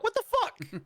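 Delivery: very high-pitched disbelief; incredulous outburst. Real, not acted. Host correcting co-host's wrong actor name, high incredulous outburst. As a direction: squeak it out, scandalized by the blunder.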